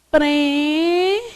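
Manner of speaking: "Prêmio" as prê, mio, first syllable held long; split into syllables. Shown as a demonstration. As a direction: separate "Prêmio" into two drawn-out syllables. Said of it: "Sons na mesma síla-, sílaba".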